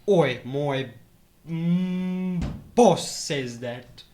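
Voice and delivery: halting mock British henchman; British accent. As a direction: drag it out in hammed-up British. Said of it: "now I just do it like how they actually say it".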